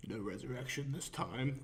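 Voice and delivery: low, hushed mock-villain line; soft, low amateur villain impression; bad Josh Brolin impression. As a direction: play a badly imitated movie villain, softly. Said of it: "a very bad Josh Brolin impression".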